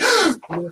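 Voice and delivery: very high, loud gasp of delight; excited gasp. Retold or imitated. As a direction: gasp like she found treasure. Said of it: "And she was like".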